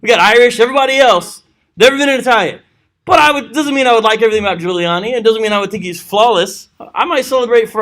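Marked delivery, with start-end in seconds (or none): 0.0-2.6 higher, loud and proud; cheering that one of us made it; mock celebration of a first Italian president; excited Italian-American. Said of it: "I'd probably be somewhat excited".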